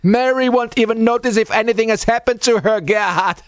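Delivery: high, loud taunting shout; jeering shout. Acted. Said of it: "Miller had shouted".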